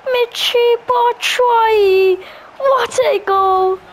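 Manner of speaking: excited goal call. Real, not acted. Goal celebration, slow drawn call.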